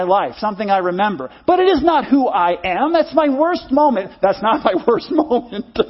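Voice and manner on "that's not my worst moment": laughing. Real, not acted. Laughs at own slip.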